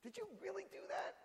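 high, shocked squeal; shocked disbelief. Pretend. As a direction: gasp out in disbelief. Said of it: "don't go in there and say".

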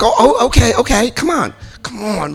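high, hurried-sounding mock appeasement; flustered pleading. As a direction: give in and plead, acting it out. Gives in, pleads for privacy.

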